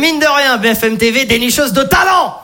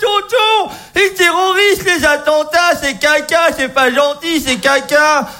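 put-on higher, slow whiny child voice; crying nephew; 5 semitones higher, slower than usual. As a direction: whimper like a sad little kid. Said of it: "il se met à chialer". (neveu qui pleurniche, voix d'enfant aiguë)

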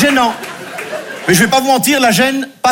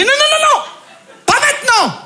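panicked high squeal. Retold or imitated. Panicked reply during sex.

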